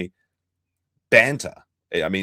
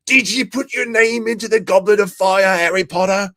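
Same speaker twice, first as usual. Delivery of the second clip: shouted high and loud though called calm; Dumbledore meme; 11 semitones higher, 6 dB louder than usual. Retold or imitated. Host yells the Dumbledore meme line, loud and high. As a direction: yell it at Harry, ironic "calmly". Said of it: "Dumbledore said calmly".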